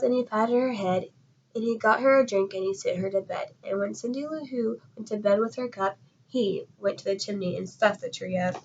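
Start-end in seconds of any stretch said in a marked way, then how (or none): none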